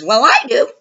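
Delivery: high, loud smug voice; a proud, silly boast; mocking Justin. Justin's proud claim, quoted.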